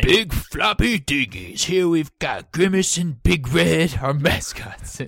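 slow, strained mock Parisian accent; attempted Parisian voice. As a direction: put on a drawn-out Parisian announcer voice. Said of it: "I can't do that voice much longer".

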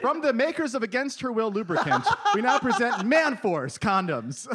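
high, loud parody advert voice; mock ad announcer. Comedian mocks a condom brand with a fake advert, announcer voice. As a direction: announce the product with hammed-up fanfare, slowing for the name.

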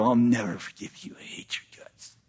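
hissed whisper, voiceless and spiteful; a spiteful whisper refusing forgiveness; bitter whisper. Acted. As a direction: breathe the grudge as a whisper. You refuse the brother.

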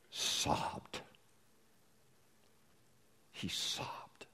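whispered. Real, not acted. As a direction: whisper the word, grieved. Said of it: "dramatic hush".

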